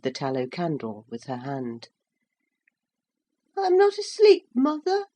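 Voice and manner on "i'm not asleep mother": put-on high child voice with tears in it; a small boy trying not to cry; high, louder little boy voice, close to tears; tearful little boy. A narrator's reading. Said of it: "she heard the tears in his voice".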